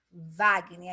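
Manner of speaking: Wagner said loud, starting with a V sound. Shown as a demonstration. Teacher showing students the W sounds like V. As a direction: say Wagner as Vagner. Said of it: "som de consoante V".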